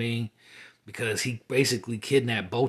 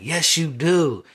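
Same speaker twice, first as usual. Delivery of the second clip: higher, louder, drawn-out mock insistence; stretched-out, raised-voice comeback; emphatic contradiction; 6 semitones higher, 8 dB louder, slower than usual. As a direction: insist slowly, acting out the retort. Dream woman contradicts him, retold.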